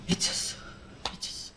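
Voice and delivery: hushed, voiceless self-reproach; re-enacted dazed near-whisper; retold stunned muttering. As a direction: mutter it under your breath, in shock. Dazed self-reproach after crash.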